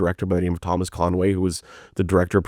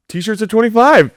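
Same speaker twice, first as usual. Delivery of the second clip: very high, loud sales call; abrupt merch pitch; 6 dB louder than usual. Retold or imitated. Comedian cutting off a fan with merch price, chirpy pitch. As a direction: chirp the price to cut him off.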